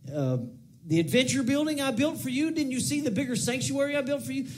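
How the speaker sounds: high-pitched, insistent mock protest; self-justifying preacher. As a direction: plead your achievements before God. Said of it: "a preacher will get to heaven".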